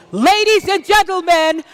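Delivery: game-show host calling out the prize winners; winner announcement. Acted. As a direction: sell every name like a grand reveal.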